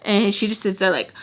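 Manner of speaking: ordinary talk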